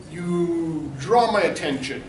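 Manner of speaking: ordinary talk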